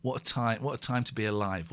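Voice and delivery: ordinary talk, nothing marked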